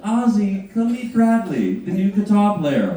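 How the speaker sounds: high mock hostess voice; Sharon. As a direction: present the new guy with flair. Voicing Sharon introducing.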